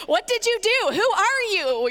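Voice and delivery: loud, high, hammed-up demand; mock interrogation. Sailors covering all the bases with Jonah, exaggerated demanding. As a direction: ham up the sailors' frantic questioning.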